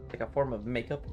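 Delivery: ordinary talk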